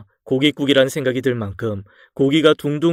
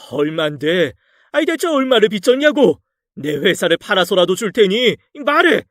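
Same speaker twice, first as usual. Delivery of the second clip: much higher, shouting at his daughter; raging shout; 6 semitones higher than usual. A narrator's reading. 빚을 캐묻는 장인, 크게 고함치듯. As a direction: bellow the demand. Father-in-law raging about debts.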